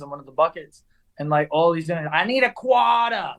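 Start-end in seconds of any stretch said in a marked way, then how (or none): 2.1-3.3 high-pitched Boston "quarter" with the r dropped; Boston accent. Retold or imitated. Street drummer in Boston asking for money, high Boston-accented call. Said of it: "I was in Boston for the first time".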